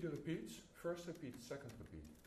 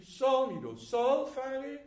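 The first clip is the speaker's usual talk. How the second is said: louder, higher sung note names; forte note names; 8 semitones higher, 11 dB louder, much slower than usual. Shown as a demonstration. Conductor singing the forte notes to the players. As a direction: sing the names out strongly. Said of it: "It's forte".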